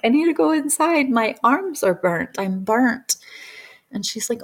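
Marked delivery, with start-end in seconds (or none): 0.0-3.2 higher-pitched child complaining, lightly acted; little kid. Child to practitioner about burnt arms, mildly distressed little kid. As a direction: imitate a kid urgently needing shelter. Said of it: "as a little kid".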